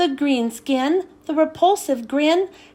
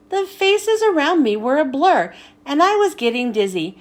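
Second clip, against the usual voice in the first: plain reading voice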